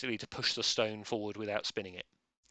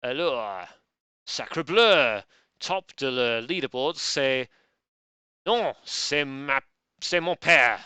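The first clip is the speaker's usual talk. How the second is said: hammed-up bad French accent, louder; 8 dB louder, slower than usual. Quizmaster reading scores to players, bad French accent. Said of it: "read in a very bad French accent".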